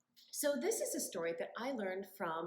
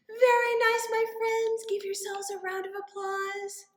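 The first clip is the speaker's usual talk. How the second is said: cheerful praise; 9 semitones higher, 11 dB louder than usual. Real, not acted. Praising children excitedly.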